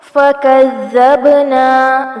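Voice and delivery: slow فکذبنا with a soft z and an echoing b; فکذبنا with a qalqala on the b; soft zaal, bouncing baa. Shown as a demonstration. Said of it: "زال کو نرمی سے پڑھیں گے".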